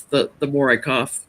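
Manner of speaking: ordinary talk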